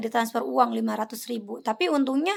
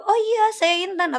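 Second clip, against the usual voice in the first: higher, eager fake yes; higher mock scammer playing along; mock scammer agreeing; 7 semitones higher, 3 dB louder than usual. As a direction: grab the offered name eagerly. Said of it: "Dia akan jawab".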